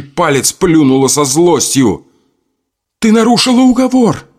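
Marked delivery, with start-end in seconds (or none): 3.0-4.3 high furious accusation; shrill-free loud outrage; angry shout. Acted. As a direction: hurl it at her in fury. Adelka shouts at mirror spirit.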